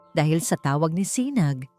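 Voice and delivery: ordinary delivery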